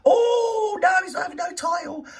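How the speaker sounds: high loud mock yell; hammed-up outraged shout. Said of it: "came out with it".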